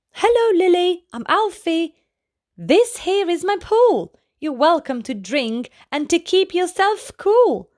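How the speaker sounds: a hopeful alligator trying to charm; cheery alligator. Acted.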